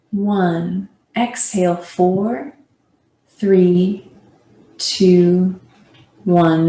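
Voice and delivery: slow, evenly spaced countdown with drawn-out numbers; measured yoga breathing count, each number stretched; breath-count guiding. Acted. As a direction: count the breath steadily, pausing between numbers. Leads a counted breathing exercise.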